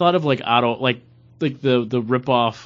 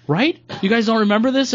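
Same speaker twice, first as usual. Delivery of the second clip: playfully ribbing a friend's surprise that nobody remembers; imitating Paul's disbelief; 10 semitones higher than usual. Friend mimics Paul asking his buddies, high and incredulous. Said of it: "and you're like".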